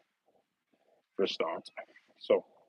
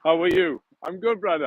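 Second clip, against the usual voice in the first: usual speaking voice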